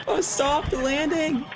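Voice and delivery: very slow, high-pitched disbelief; drawn-out astonished repeat; incredulous echo. Real, not acted.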